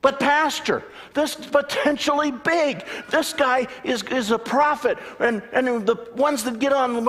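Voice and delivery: high, loud indignant pleading; hammed-up outraged churchgoer; upset excited congregant. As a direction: protest in high agitation. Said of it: "he was upset with me".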